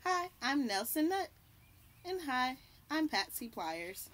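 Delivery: usual speaking voice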